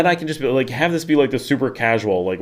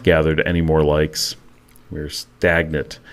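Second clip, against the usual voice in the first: slow, lower word drawn out; deep radio voice; 6 semitones lower, slower than usual. Shown as a demonstration. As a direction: drop low and draw out stagnant. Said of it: "talking like this".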